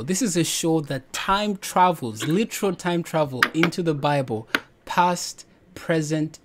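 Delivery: normal talking voice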